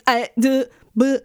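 stammering mock confusion; lost for an answer. Retelling being stuck between Finnish and German, tongue-tied stammer. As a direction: start and break off, tongue-tied. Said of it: "I had no idea how to answer".

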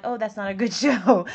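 a lightly put-on dismissive listener voice; mock snooty listener. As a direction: sniff at the show like a picky listener. Imagined listeners judging the show.

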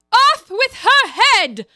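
huge high slow bellow; shouting. A narrator's reading. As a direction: roar the sentence slowly, way up high.

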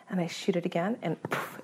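ordinary talk

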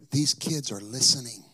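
ordinary talk, nothing marked

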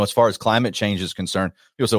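ordinary talk

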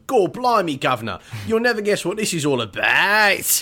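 high, loud Cockney news-bringer; Cockney accent. Retold or imitated. Cockney local rushing in to tell the newspaper of aliens on the heath. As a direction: burst in with Cockney excitement. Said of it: "in the accent which we've seen".

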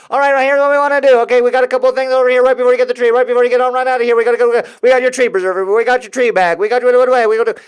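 high, loud, very fast auctioneer rattle; machine-gun sales patter, no breaths. Retold or imitated. Saleswoman rattling off tree add-ons, fast auctioneer patter. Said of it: "now she's like an auctioneer".

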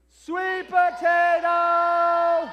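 long high loud call; yelling a name. Real, not acted. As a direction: yell it across the room. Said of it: "let's yell for Sweet Potato".

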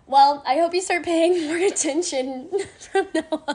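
mock-stern teacher tone, chuckling mid-line; a hint of a scolding instructor; imitating the instructor. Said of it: "my instructor is like".

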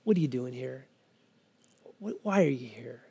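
low, hushed, gentle question; tender probing. Acted. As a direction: softly draw her out. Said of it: "it's in a tender voice".

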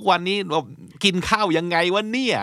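high-pitched, incredulous ribbing of a friend; mock exasperated telling-off. Acted telling-off of artistic friend. (แกล้งบ่นเพื่อนเสียงสูงแบบเหลืออด)

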